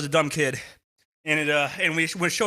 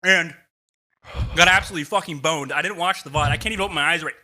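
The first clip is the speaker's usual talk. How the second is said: normal talking voice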